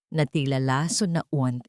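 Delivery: ordinary delivery, nothing marked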